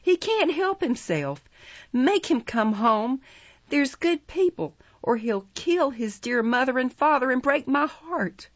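high-pitched imploring; appealing to bystanders. A narrator's reading. Nancy begging bystanders for help, high pleading. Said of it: "appealing to the bystanders".

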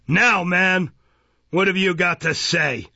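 loud, high, testy demand; Mister Fang, impatient. A narrator's reading. Magistrate grudgingly questioning the witness, impatient.

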